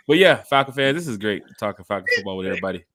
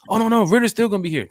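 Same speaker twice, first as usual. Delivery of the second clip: higher, louder mock fan; mock fan denial; 9 semitones higher, 5 dB louder, faster than usual. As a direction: scoff as the sure fan. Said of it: "fan insisting".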